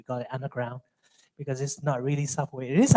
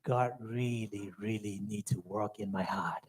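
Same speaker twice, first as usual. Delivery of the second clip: 1 humble confession; 6 semitones lower, 11 dB quieter than usual. Real, not acted. Confessing his pride before God.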